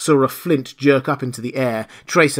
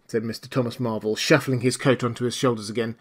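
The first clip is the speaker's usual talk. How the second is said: ordinary delivery, nothing marked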